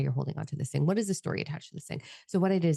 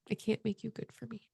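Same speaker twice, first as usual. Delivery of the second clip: near tears; 6 semitones higher, 8 dB quieter than usual. Retold or imitated. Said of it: "And I said".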